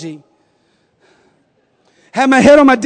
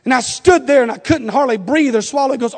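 ordinary talk, nothing marked

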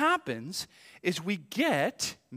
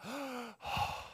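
sharp gasp of relief; 8 semitones higher, 10 dB quieter than usual. Retold or imitated. Relief after the police passed.

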